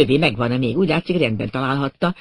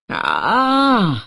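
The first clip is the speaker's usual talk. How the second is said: high drawn-out cry of pain; anguished scream; 6 semitones higher than usual. A narrator's reading. Unknown victim screaming behind the bushes, anguished. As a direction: cry out "Áh" in agony. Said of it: "ordított az ismeretlen bokrok mögött".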